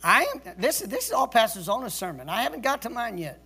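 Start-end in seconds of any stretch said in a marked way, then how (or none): none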